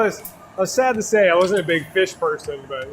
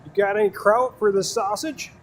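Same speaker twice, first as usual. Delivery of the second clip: put-on Wisconsin accent; 5 semitones higher than usual. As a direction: play the cheese-and-kraut Wisconsin local. Hamming up Wisconsin stereotypes.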